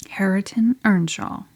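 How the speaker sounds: plain reading voice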